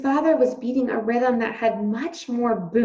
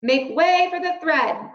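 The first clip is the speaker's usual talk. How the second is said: loud, higher announcing call; crying out; 7 semitones higher, 7 dB louder than usual. A narrator's reading. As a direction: clear the crowd with a call. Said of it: "cried a mouse".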